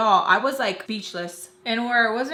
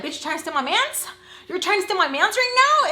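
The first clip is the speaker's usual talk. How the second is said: high, fast, building louder; mock jealous accusation; 8 semitones higher, 3 dB louder, faster than usual. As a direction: accuse in an imagined outraged voice. Imagined jealous accusation acted out.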